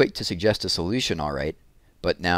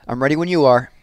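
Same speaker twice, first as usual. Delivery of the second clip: called out, louder, to the far end of the gym; calling out; 6 semitones higher, 8 dB louder than usual. A narrator's reading. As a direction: call across the big room to a student. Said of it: "Jim called".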